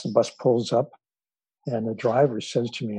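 normal talking voice

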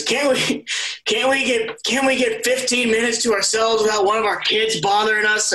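high, whiny put-upon dad voice; exasperated dad. Retold or imitated. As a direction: imitate a fed-up father begging for peace. Dad complaining in background.

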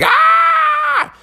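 high-pitched cockatoo screech; imitated bird scream. Cockatoo reacting as dogs lick it, high screech.

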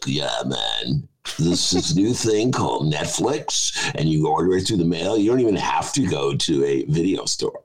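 low, laid-back imitation of a guy; Gen X slacker. Video store regular hears of Netflix, low Gen Xer impersonation. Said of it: "He was a Gen Xer".

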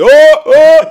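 very high, loud holler; roepen door het huis. Retold or imitated. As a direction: holler wordlessly through the house. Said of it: "gewoon op die manier". (hard en hoog door het huis roepen)

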